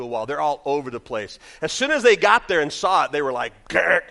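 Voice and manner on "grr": loud rough "grr"; imitated grabbing noise. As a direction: imitate eager grabbing with a growl. Imitates kids grabbing at mics.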